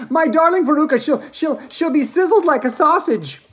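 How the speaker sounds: high, loud, stammering distress; yelled. Acted. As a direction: wail loudly as a horrified mother. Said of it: "yelled Missus Salt".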